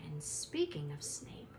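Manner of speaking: ominous unvoiced whisper. A narrator's reading. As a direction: drop to a dark whisper. Ominous aside in narration.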